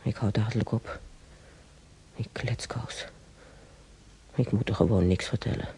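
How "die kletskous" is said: a soft, breathy aside to herself; under the breath, scolding herself; whispered aside. Acted. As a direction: whisper it, half ashamed. Muttered aside about herself.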